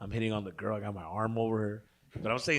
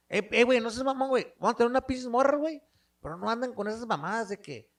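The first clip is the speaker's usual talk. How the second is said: higher, louder mock paisa cousin; 10 semitones higher, 6 dB louder, faster than usual. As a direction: play the pushy paisa cousin, pitched up. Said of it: "my cousin, you know, paisa".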